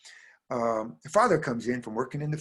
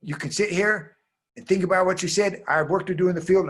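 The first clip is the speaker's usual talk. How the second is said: louder, higher, quick scolding; angry father; 7 semitones higher, 6 dB louder than usual. Acted. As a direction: scold briskly as a cross father. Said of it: "made the father angry".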